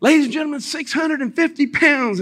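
high, stretched-out announcer call; mock event announcer. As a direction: announce a record lift to the arena. Acting the lifting event's announcer.

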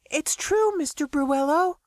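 very high-pitched, polite character voice; Talia confirming. A narrator's reading. Talia confirms the story to Max, high-pitched and earnest. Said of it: "Talia confirmed".

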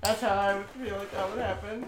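ordinary talk, nothing marked

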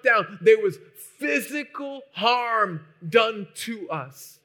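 the preacher booming out Paul's words to the church, slow and loud; preaching at full force. Acted. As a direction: thunder Paul's words out slowly. Preacher voicing Paul's suffering.

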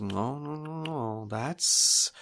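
high, soft, slow tutting menace; mocking villain. Old swordsman mockingly scolds the younger sister. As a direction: scold her like a naughty child, then coax. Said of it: "he goes".

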